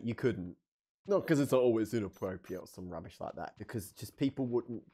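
usual speaking voice

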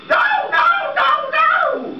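very high squealing no; shrieking falsetto. Acted. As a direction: shriek it in a tiny voice. Puppet panics at the noose.

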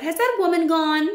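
high, loud call of Grandmama; boy shouting down to grandmother, high and loud. A narrator's reading. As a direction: call out anxiously from the tree. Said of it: "I shouted".

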